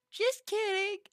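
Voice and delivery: high squeaky just kidding; playful squeak. Pretend. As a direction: squeal it teasingly. Teasing chat after calling them lame.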